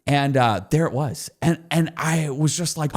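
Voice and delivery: normal talking voice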